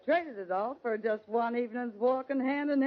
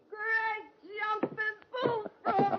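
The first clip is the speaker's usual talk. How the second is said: surprised exclamation; 10 semitones higher, slower than usual. Acted. Lil spotting her old flame California at the door, astonished. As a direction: voice climbs high and slows in astonishment.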